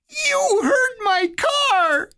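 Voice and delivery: high, drawn-out crying wail; squalling child. A narrator's reading. Little Eddie squalling at his brother over the broken car. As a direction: wail it like a hurt little kid. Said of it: "Eddie squalled".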